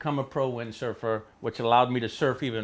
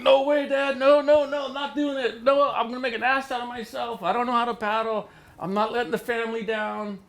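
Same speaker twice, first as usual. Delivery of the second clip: high, mock-panicked pleading with dad; panicked refusal; 9 semitones higher than usual. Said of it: "he could tell I was serious and I was afraid".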